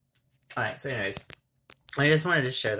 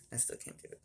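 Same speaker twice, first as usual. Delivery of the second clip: whisper; 14 dB quieter, faster than usual. Real, not acted. Struggling to say needless to say.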